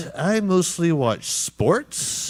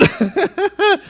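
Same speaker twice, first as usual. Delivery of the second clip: high burst of laughter; shrieking laugh; 5 dB louder than usual. Real, not acted. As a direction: break into a loud shrieking laugh. Laugh burst after the shout.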